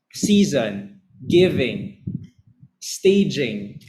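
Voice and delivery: first syllable stressed on "Season", "Giving", "Staging"; DUM-da example words; trochee examples. Shown as a demonstration. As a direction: fall after a strong opening beat. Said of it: "trochee ang tawag dyan".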